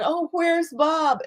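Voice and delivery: high, hopeful asking after her husband; forgetful patient asks her friend about her husband, high and hopeful; innocent eager question. Acted. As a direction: ask lightly, not knowing.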